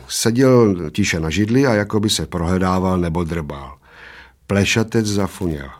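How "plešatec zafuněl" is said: plain reading voice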